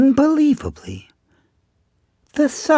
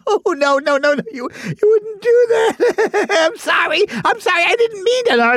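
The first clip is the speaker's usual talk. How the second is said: higher, panicky stammered apology; pleading chipmunk; 6 semitones higher than usual. Acted. Chipmunk apologizes to the bear pinning him, panicky. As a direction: beg with a nervous whimpering laugh.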